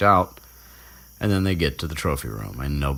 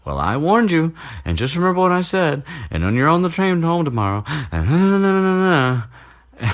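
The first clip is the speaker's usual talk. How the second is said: high, loud, sing-song scolding; mocking nag; 12 semitones higher, 5 dB louder than usual. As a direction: mimic a know-it-all's tirade, trailing into nonsense. Mocking Hermione's parting warning, nagging.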